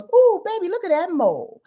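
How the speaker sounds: high, cooing compliment; admiring partner. Retold or imitated. Partner admiring a mole on you, high cooing. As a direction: coo it like a smitten partner. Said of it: "partner that comes around and goes".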